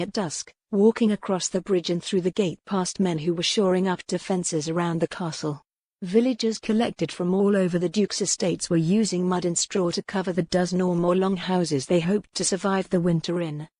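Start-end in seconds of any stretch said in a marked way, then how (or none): none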